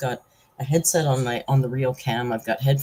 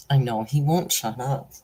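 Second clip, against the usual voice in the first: usual speaking voice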